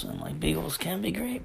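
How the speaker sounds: ordinary talk, nothing marked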